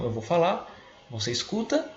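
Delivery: normal talking voice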